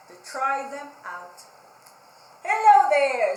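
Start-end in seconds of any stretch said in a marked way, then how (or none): 2.4-3.4 much louder greeting call; loud hello. Acted. Pig calling out to Kangaroo. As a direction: sing out hello. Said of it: "said Pig to Kangaroo".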